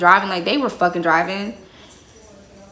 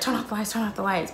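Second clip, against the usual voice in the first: softer hurried "turn off the lights"; urgent hush; 8 dB quieter, faster than usual. Retold or imitated. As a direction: urge your friend to hide, quietly. Hiding from banging van.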